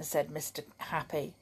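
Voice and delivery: usual speaking voice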